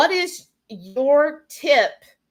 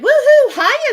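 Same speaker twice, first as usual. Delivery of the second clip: excited woohoo; 13 semitones higher, 10 dB louder than usual. Retold or imitated. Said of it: "Shauna said".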